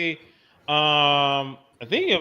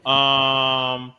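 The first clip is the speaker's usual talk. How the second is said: Al Roker; 2 semitones lower than usual. Acted. Co-host reading game-day temperatures to listeners, weatherman impression. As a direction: do a weather forecaster, falling away on the temperatures. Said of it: "Al Roker voice".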